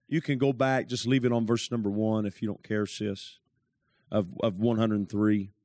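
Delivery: ordinary talk, nothing marked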